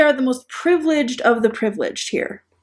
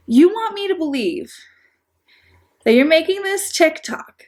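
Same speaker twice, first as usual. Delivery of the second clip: you want me to believe, pitched up in disbelief; sceptical, as if calling out a story; a raised, disbelieving challenge; incredulous; 6 semitones higher than usual. Real, not acted. Incredulous address to TikToker.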